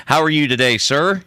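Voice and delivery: ordinary talk, nothing marked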